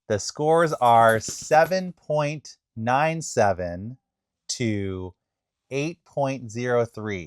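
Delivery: drawn out, slow results reading; slow suspense reveal. Real, not acted. Host reading the results to his buddy, slow and suspenseful. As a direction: hold every number to build suspense.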